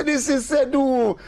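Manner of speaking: slow, high, deliberate insistence. Retold or imitated. Clive slowly insisting to the lady, high. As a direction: spell it out slowly.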